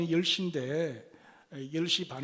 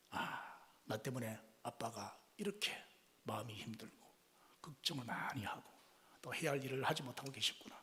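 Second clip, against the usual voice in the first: much softer, musing daughter; soft realization; 5 semitones lower, 13 dB quieter than usual. Acted. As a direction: realize it gently to yourself. Daughter's inner realization voiced. (조용히 깨닫는 딸)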